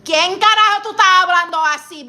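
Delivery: loud, high furious Spanish scolding; angry abuela. Acted. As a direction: let the angry grandmother burst out of you. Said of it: "That was one of my abuelas".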